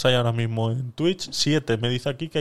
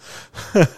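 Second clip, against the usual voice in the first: short laugh; 6 semitones higher, 4 dB louder than usual. Real, not acted. Laughs at own joke.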